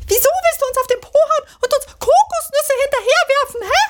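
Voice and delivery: quietschig hoch und hastig; hohe, schnelle Figurenstimme. Acted. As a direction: piepsig empört fragen. Swinguru fragt den Affen. (schnelle, hohe Kuscheltierstimme)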